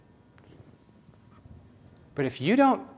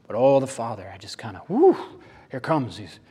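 ordinary talk, nothing marked

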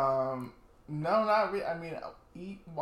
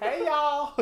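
put-on French-accented hey y'all, high and laughing; mock French accent; 10 semitones higher, 7 dB louder than usual. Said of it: "French accents are so strange".

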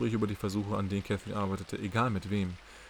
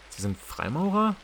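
surprised; 7 semitones higher, 4 dB louder, slower than usual. Acted. Catherine erkennt den Freimaurer-Phönix.

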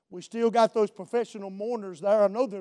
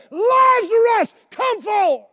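high, loud, drawn-out summons; booming preacher's command at full force; full-out shout; 12 semitones higher, 8 dB louder, much slower than usual. Retold or imitated. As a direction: holler it across the whole area. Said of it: "expelled this out".

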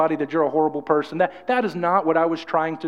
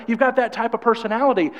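ordinary delivery

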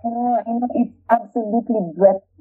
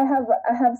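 ordinary delivery

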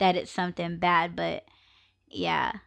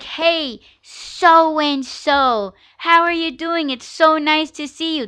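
put-on high, bright-eyed hello to an old friend; friendly greeting; 7 semitones higher, 4 dB louder, slower than usual. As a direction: greet them like you're thrilled to see them. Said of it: "I'm like".

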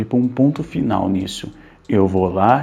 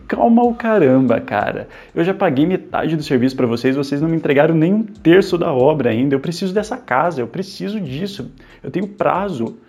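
angry outburst; 6 semitones higher, 4 dB louder than usual. Acted. Furious reply to "calma.

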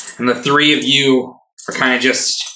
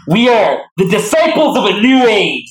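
grand declaration; 8 semitones higher, 4 dB louder than usual. Acted.

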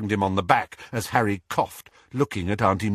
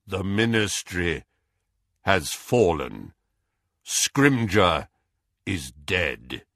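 lower, slow, grave messenger voice; Kingsley Shacklebolt; 6 semitones lower, slower than usual. A narrator's reading. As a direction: deliver the warning deep and unhurried. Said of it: "the loud, deep, slow voice of Kingsley Shacklebolt".

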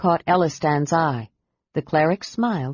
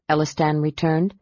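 plain reading voice

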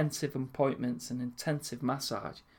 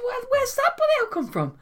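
very high, shrieking mock mum; mum's outrage. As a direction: play the scandalised mum. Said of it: "She said".

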